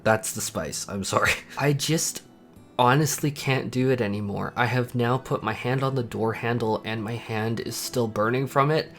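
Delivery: usual speaking voice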